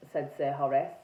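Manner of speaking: ordinary delivery, nothing marked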